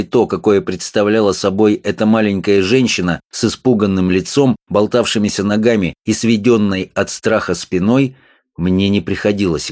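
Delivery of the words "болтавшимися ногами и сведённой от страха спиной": plain reading voice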